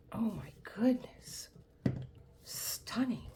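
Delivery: slow whispered wonder; amazed whisper. Real, not acted. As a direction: whisper it slowly, in awe. Amazed by the next kit.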